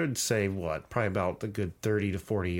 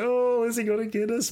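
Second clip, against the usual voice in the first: high, hammed-up fearful question; mock fright; 12 semitones higher than usual. Hayride adults faking fear at the axe man, high squeal. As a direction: squeal it like a jokey scared adult.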